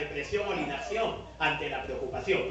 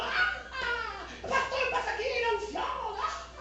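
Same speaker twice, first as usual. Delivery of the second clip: hammed-up shrieking preacher, much higher; mock ecstatic shriek; slower than usual. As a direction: screech it like a frenzied revivalist. Mocking a shrieking pastor.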